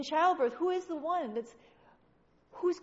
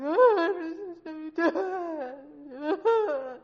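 hammed-up wailing, very drawn out; exaggerated sobbing meltdown; mock freak-out; 4 semitones higher, 4 dB louder, much slower than usual. Believers freaking out in that place, mock wailing. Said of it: "we're freaking out. We're just like".

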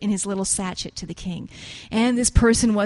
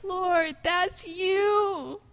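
high, slow, choked-up plea; a hint of the tears, pitched up high and drawn out; reliving the moment of weeping, lightly; retold sobbing; 8 semitones higher, much slower than usual. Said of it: "And I just started sobbing".

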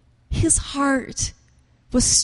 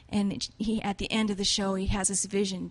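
ordinary talk, nothing marked

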